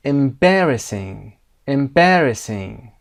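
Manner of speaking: EMBARRASSING slowly, with a loud BAR; stress on BAR. Shown as a demonstration. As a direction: punch the second syllable, BAR, in EMBARRASSING.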